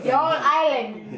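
slow, loud mock Jamaican accent; drawn-out fake Irish Jamaican accent. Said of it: "That's an Irish Ja-, Jamaican accent".

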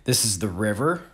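normal talking voice

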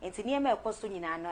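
ordinary talk

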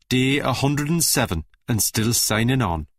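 mock Geordie narrator accent; low, deadpan Geordie voiceover impression; Big Brother voiceover. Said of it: "how that voiceover guy is doing".

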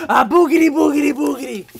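put-on boogity-boogity at a baby, lively and teasing; playful baby tickle talk. Dad tickling his baby son, playful nonsense babble.

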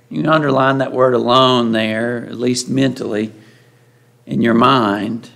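normal talking voice

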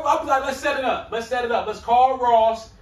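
normal talking voice

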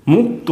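মুক্ত as high rising "muk", then "to"; syllable-by-syllable pronunciation. Shown as a demonstration. Teacher showing how the conjunct is pronounced, মুক্ত broken as "muk-to". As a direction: split মুক্ত after the k, lifting "muk". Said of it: "কীরকম উচ্চারণ হবে".

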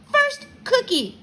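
put-on high, slow, loud toddler demand; demanding little kid. A narrator's reading. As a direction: insist like a small child. Toddler bargaining for a cookie.

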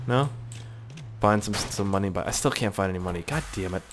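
ordinary talk, nothing marked

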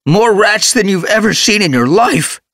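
high, loud, shaken outburst; shocked gasp. A narrator's reading. Warrior gasping to his leader after the rat attack, high and loud. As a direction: blurt it out still in shock. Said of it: "Short Whisker gasped".

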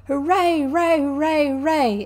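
loud, high run of cheers; ecstatic, ringing cheering; jubilant cry. A narrator's reading. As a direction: whoop it over and over in delight. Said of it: "the Rat cried".

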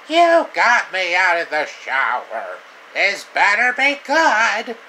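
annoyed grumble. Acted. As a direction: huff at being pulled out of the shower. Annoyed character dragged from shower.

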